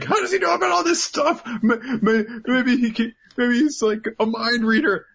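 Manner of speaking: high, panicky stammering; hammed-up inner freak-out; frantic racing thoughts. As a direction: spiral out in a high mock panic. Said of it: "he's thinking about a million things in a second".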